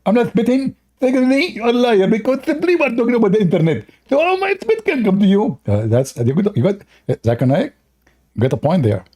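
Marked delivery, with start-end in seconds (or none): 0.1-5.6 much higher, fast defensive mock voice; Zakir Naik. Mock opponent denying the spitting, squeaky and fast. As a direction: protest in a squeaky caricature.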